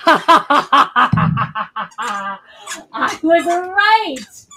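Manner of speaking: laughing "I was right"; laughing triumph. Real, not acted. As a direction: laugh through it, pleased. Laughs after guessing toy.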